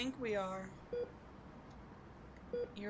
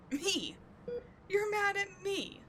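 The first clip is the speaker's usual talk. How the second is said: higher, slow mock disbelief; mock incredulity; 11 semitones higher, 4 dB louder, slower than usual. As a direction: feign shock at the captive's anger. Mock surprise at anger.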